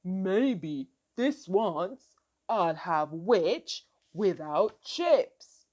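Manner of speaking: low, slow, loud dragon voice; deep, drawn-out monster threat; menacing dragon. A narrator's reading. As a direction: rumble it slowly like a hungry dragon. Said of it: "Then he said".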